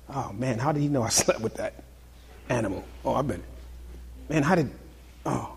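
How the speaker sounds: low, very soft mock dismay; sheepish muttering. Preacher voices exposed accusers leaving one by one, sheepish muttering. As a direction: mutter to yourself like someone caught out. Voices convicted men slinking away.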